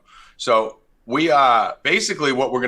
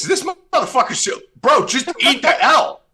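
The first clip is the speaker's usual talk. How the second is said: high, loud disbelieving shout; laugh-yell at the lame excuse; incredulous; 5 dB louder than usual. Real, not acted. Host mocking co-host's injury excuse, shouting high.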